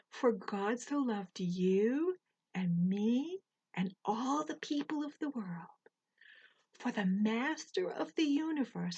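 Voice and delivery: gently recited Bible verse; verse recitation. Acted. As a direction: recite it as scripture to the children.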